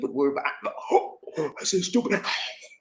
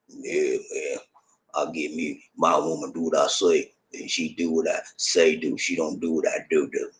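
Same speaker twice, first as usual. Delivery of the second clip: put-on domineering good old boy; 13 semitones lower, 8 dB quieter than usual. Mocking a controlling husband's boasts to his woman, in a low mock tough voice. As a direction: play the bossy husband laying down the law, way down low. Imitating a domineering southern guy.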